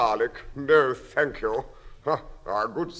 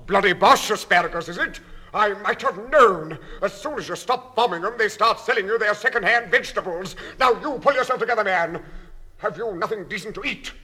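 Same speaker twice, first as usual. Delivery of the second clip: louder, higher blustering tirade; indignant rant; 6 semitones higher, 6 dB louder than usual. Acted. Diner ranting at the waiter, indignant and loud. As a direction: bluster up into outrage.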